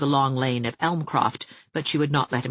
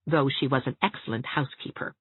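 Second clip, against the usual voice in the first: ordinary delivery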